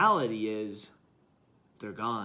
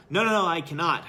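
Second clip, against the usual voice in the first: firm refusing monk; 3 semitones higher, 7 dB louder, faster than usual. Acted.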